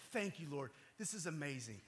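grateful prayer. Retold or imitated. Dad thanking God for the deer, quietly grateful. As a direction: drop into hushed thanks.

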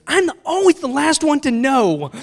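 loud, high-pitched exasperated wail; mock indignant outburst. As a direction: imitate an aggrieved daughter, shouting high. Daughter's outraged complaint, retold.

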